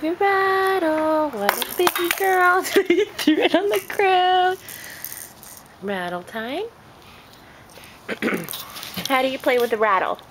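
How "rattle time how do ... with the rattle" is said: normal talking voice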